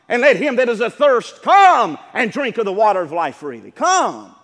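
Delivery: loud, ringing scripture call; preaching at full force. Acted. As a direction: cry out the invitation to the congregation.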